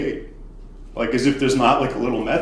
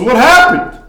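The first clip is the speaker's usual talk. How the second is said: higher and louder, alarmed; alarmed shout; 12 semitones higher, 9 dB louder than usual. Retold or imitated. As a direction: shout it at the sight of blood. Brother sees his bleeding sister.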